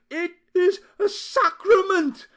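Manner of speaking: loud, high, beside herself; frantic cry. A narrator's reading. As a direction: scream it in outrage. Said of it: "cried Aunt Porreda, beside herself".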